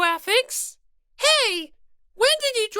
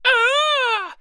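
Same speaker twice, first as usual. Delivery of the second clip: startled cry; 2 semitones higher than usual. Acted. Little sister stung by the diaper jab, startled yelp.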